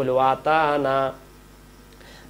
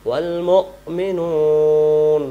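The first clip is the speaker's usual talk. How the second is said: slow, louder chanted recitation; Quran recitation; 6 dB louder, much slower than usual. Shown as a demonstration. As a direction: recite wall মুমিনুন in tajweed. Recites verse. (ধীর তিলাওয়াত)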